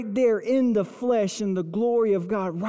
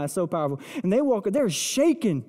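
ordinary talk, nothing marked

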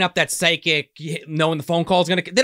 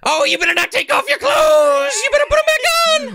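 very high, loud mock granny yell; co-host voicing Granny Van Dam scolding the couple; shrieking old granny; 5 dB louder than usual. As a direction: screech at the teenagers.

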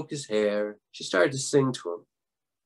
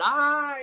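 high-pitched storyteller's yelp; sudden held cry of pain, acted; high mock scream; 12 semitones higher than usual. A narrator's reading. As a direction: yelp high as if hair was plucked. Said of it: "he screamed".

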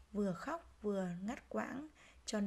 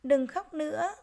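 higher, louder storybook cry of encouragement; sudden exclaiming; 6 semitones higher, 6 dB louder, slower than usual. A narrator's reading. Swallow promising to help the crying grass, exclaiming. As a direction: burst out brightly to cheer someone up. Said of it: "rồi bỗng reo lên".

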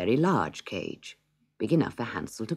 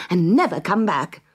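louder, higher call; crying out to her brother; 6 semitones higher, 8 dB louder than usual. Acted. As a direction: urge him to flee the forest for good. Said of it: "she cried".

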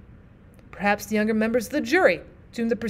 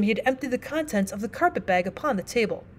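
plain reading voice

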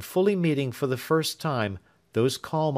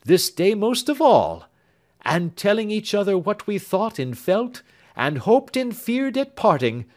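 put-on raised, tender young lover's voice; lightly voiced earnest young man; 8 semitones higher, 5 dB louder than usual. Young man tells Marian of Grace, tender and higher. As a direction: speak fondly of the absent sister. Alfred continues farewell speech.

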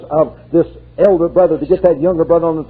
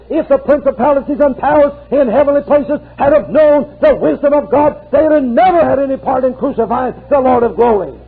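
high, ringing preacher's cry; fervent revival preaching; preaching at full force; 11 semitones higher than usual. Acted. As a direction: preach it out, lifting the congregation.

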